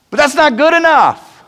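climactic preaching. Real, not acted. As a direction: drive home It's not who you are. Preacher's climax, slow final line.

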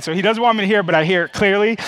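usual speaking voice

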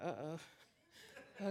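a low laughing uh-oh; nervous uh-oh. Retold or imitated. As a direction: brace for bad news with a nervous chuckle. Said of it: "I was like".

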